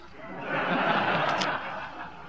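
hearty far-off chuckle; amused laugh. Acted. Molly laughs at secretary.

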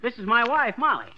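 loud, high, laughing introduction; hearty. Acted. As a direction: announce yourself with a laugh.

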